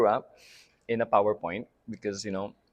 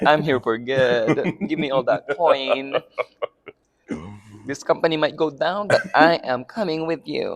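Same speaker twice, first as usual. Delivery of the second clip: mock confident diva; 5 semitones higher, 10 dB louder than usual. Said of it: "She's like".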